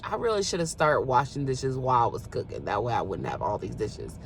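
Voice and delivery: low, soft muttered regret; regretful self-talk. Retold or imitated. Woman scolding herself over piles of dishes, low and soft. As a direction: grumble it to yourself, low and quiet. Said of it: "and I'm like".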